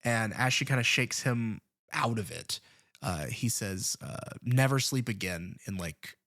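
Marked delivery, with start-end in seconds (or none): none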